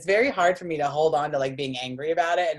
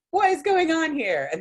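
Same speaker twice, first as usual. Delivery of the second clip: high, loud mock demand; retold exasperated outcry; acted indignant bewilderment; 13 semitones higher, 5 dB louder than usual. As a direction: demand answers in exaggerated disbelief. Demanding explanation from laughing mother.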